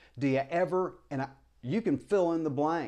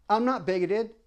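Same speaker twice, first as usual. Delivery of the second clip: mock denial; 8 semitones higher, 6 dB louder than usual. Mocking quoted denial.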